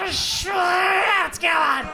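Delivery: very loud, high, slow shouted "Spritzkuchen"; furious shout. Retold or imitated. Furious baker with the queue forming, loud high mock scream. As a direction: scream the word at him. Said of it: "she was like".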